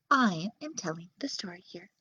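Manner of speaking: a hissed, exasperated aside; annoyed whisper. Acted. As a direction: whisper it in irritation. Narrator scolds the characters.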